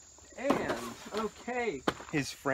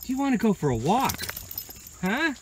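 loud, high, eager invitation; high-pitched, full-volume excitement; excited dog talk; 12 semitones higher, 15 dB louder than usual. Real, not acted. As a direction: get the dog worked up for a walk. Rousing dog for a walk.

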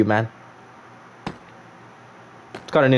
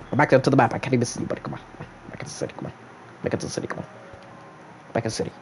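ever softer, dwindling to almost nothing; fading muttered plea; 10 dB quieter, much faster than usual. Pretend. Player urges buddy back to the city, trailing into near silence. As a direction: repeat the call, fading away.

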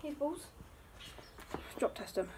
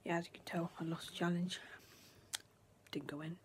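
whispering; 7 semitones lower than usual. Real, not acted. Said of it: "I have to whisper now".

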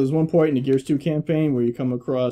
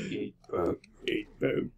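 husky, whispered one-liner; game catchphrase; 4 semitones lower, 11 dB quieter, slower than usual. Retold or imitated. Player parroting a repeated game line, hushed and gruff. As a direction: repeat it low and voiceless. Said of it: "three lines that we just keep repeating".

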